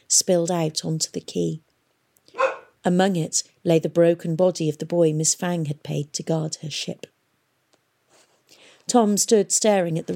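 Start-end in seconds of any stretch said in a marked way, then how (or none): none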